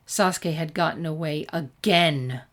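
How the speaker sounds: ordinary delivery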